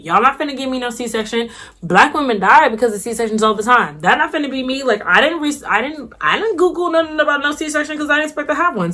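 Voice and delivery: worked-up, high-pitched protest; agitated, voice climbing as it goes; freaking out. Retold or imitated. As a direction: reenact a panicked refusal. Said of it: "So I'm freaking out".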